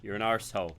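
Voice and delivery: ordinary delivery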